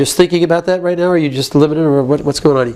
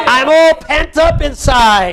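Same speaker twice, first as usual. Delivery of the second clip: higher, slow, drawn-out outcry; modelled pent-up anger; 5 semitones higher, slower than usual. Shown as a demonstration. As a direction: stretch each word with pressure. Coach models venting.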